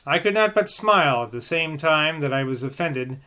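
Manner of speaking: put-on measured, even reading of an old text; calm literary reading voice; reading aloud. A narrator's reading. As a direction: read it like a steady audiobook narrator.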